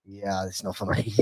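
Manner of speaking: low quiet mock refusal; dismissive. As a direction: mumble it away, not interested. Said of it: "and I said".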